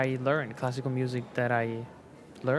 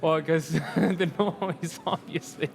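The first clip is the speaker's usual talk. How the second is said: laughing through the words; chuckling as he explains; laughing it off, fading to a breathy laugh; 3 semitones higher, slower than usual. Real, not acted. Laughing about parents banning drums.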